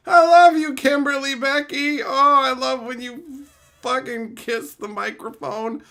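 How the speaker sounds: high, gushing pretend devotion; mock lovesick fan. Streamer mimics a lonely viewer adoring ASMR girls, mock lovesick. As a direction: gush in a high silly voice like an infatuated fan.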